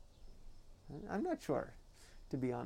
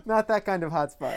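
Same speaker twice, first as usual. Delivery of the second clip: laughing; 4 semitones higher, 9 dB louder than usual. Real, not acted. Joking, laughing.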